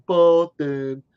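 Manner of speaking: slow mock Boston drawl on Boston; mock Boston accent. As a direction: imitate how Bostonians say Boston. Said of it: "They can't say Boston".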